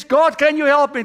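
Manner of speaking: high, loud cry for help; shouted plea. Retold or imitated. Hanging man begs God for help, shouting. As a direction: plead loudly to someone far above.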